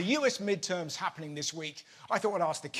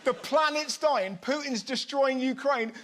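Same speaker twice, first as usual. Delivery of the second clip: ordinary talk, nothing marked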